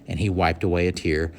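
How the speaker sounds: ordinary delivery, nothing marked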